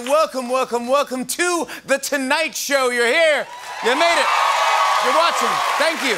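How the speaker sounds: loud, high, fired-up welcome; excited greeting. Real, not acted. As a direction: greet the crowd with big energy. Host greets cheering audience.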